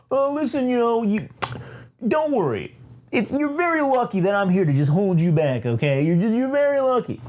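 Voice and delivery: high, put-on condescending voice; smug chancellor. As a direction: talk down to a rival in a high voice. Doctor Phil character taunting the queen.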